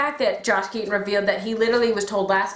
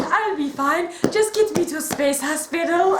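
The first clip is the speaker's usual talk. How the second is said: higher, brave dying-hero line; mock eighties hero; 7 semitones higher than usual. Fan voicing wounded Sven, hammed-up brave. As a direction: brush it off heroically. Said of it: "really poking fun at the eighties Keith".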